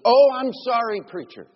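startled apology. Retold or imitated. Cursing woman apologizes to preacher.

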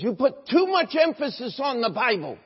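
high-pitched, indignant complaint; imitated angry accusation. Church member in serious argument with pastor, imitated indignant accusation. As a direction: act out a heated objection.

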